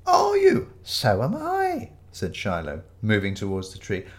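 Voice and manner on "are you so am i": put-on very high, slowing old voice; quavery elderly vicar. Fake vicar tells Ramage he's paying too, high and quavery. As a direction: chirp the challenge as a sweet old vicar.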